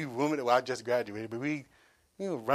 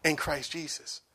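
usual speaking voice

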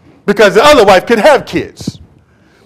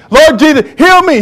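high pleading cry to Jesus; 9 semitones higher, 4 dB louder than usual. Retold or imitated. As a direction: beg loudly like a blind man calling out. Blind man crying out to Jesus.

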